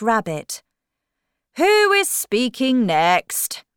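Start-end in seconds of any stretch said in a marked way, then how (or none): 1.6-3.6 slow, loud, raised questioning; storybook king. Acted. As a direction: ask loudly and slowly as king. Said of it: "he asked".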